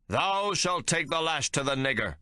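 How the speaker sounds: a zealot captain condemning Hawke; thundering captain. A narrator's reading. Captain orders the lash.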